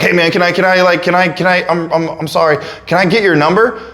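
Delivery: imitating a flustered, high-pitched driver; mock frantic, tripping over the request; freaking out. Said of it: "is still freaking out".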